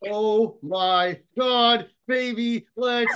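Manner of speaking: drawn-out, high, thrilled cry; excited. Real, not acted. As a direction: celebrate at the top of your voice. Celebrating the big signing.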